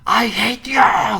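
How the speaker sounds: put-on very high, loud scream of a furious kid; child screaming at mom. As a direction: yell it back at your mother across the schoolyard. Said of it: "고함을 쳐지르면서".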